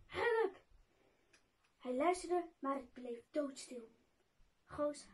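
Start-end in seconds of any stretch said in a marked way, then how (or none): 0.1-0.6 single higher-pitched, narrated cry for help; calling for help. A narrator's reading. Goos calls for rescue, acted higher-pitched cry. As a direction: call out for help in a storyteller's voice. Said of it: "riep Goos".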